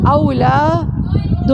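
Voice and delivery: "اولاد" stretched very slowly, au-laad; very slow demo. Shown as a demonstration. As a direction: draw "اولاد" out for students. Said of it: "یہاں پہ single پیش ہے نا".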